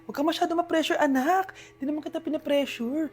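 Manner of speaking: soft, low reassuring mother; mother reassuring her stressed child, soft and low; tender motherly. Acted.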